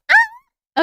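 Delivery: sharp high startled cry; sudden yelp. Real, not acted. Streamer startled mid-chat, sudden high yelp. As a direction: caught off guard, yelp out.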